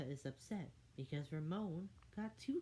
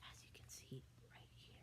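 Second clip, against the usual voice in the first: a hushed, breathy aside to the listener; whispered aside; 18 dB quieter, faster than usual. A narrator's reading. Narrator whispers to the child, pointing at the picture.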